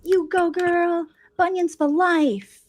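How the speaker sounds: high, slow, adoring fan voices; gushing fans. Retold or imitated. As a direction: cheer her on like a devoted fan. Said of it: "And they're like".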